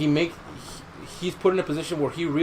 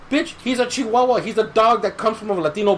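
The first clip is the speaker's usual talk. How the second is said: high, loud exasperated retort; 8 semitones higher, 5 dB louder than usual. Real, not acted. Retorting to touchy critics, exasperated and loud. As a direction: throw it back at them, fed up.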